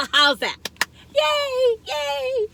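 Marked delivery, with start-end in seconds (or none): 1.2-2.5 a high, squealing double yay; excited cheer. Real, not acted. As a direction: cheer like you just won a prize. Cheering her own thumbs up.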